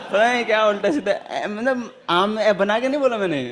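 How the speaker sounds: can't stop laughing at the memory. Real, not acted. Laughing as he tells listeners about the silly reading. Laughing while recalling the man.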